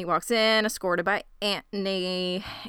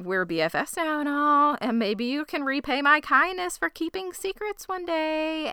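sly higher voice; 7 semitones higher than usual. Acted. Voicing a sly character line.

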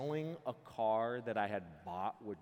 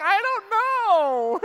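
slow, much higher whimpering drawl; imitating a girl crying and lost for words; mock crying girl; 18 dB louder, much slower than usual. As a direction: stretch it out like a teary girl who can't explain. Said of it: "You're like".

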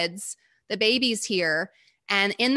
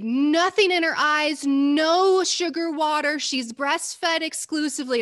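put-on higher, louder, urgent child's plea; a ten-year-old pleading with the nurse, raised and frantic; frantic demand; 6 semitones higher, 3 dB louder than usual. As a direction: insist desperately through the glass.

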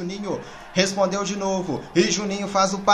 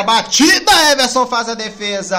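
excited play-by-play; 5 semitones higher, 7 dB louder than usual. Real, not acted. Chance, live play-by-play.

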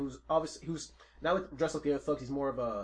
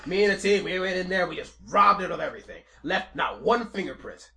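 a lightly voiced mafia boss bragging, louder; boastful mafia boss; 5 semitones higher, 10 dB louder than usual. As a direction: brag about the heist like a proud gangster.

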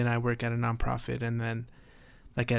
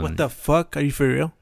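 louder, incredulous reaction; shocked disbelief; 3 semitones higher, 7 dB louder than usual. Real, not acted. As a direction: blurt it out in disbelief. Reacts to news of a death.

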